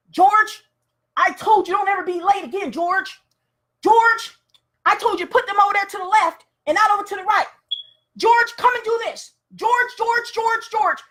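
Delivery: high, nagging boss voice calling George over and over; bossy nagging boss. Acted. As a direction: whine at George like a pushy boss. Boss scolding George at work.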